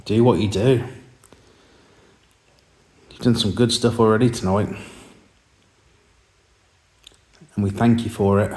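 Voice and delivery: firm, projected call to a ghost; calling out to spirits. Acted. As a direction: address the presence directly. Addressing the spirit.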